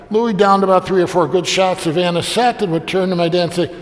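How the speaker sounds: usual speaking voice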